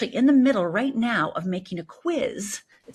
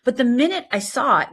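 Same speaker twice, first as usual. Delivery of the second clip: ordinary talk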